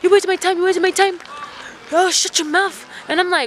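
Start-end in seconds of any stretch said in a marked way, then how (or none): 0.0-1.2 high, loud mock impatience; rude shopkeeper. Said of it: "has been super rude". 1.9-2.9 high-pitched mock irritation; rude shopkeeper. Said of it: "has been super rude".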